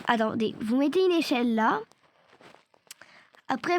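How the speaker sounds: normal talking voice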